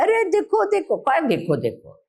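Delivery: high, eager calling out to look; a grandparent thrilled by a toy; excited grandparent. Retold or imitated. Grandparent showing toys to bored grandkids, excited.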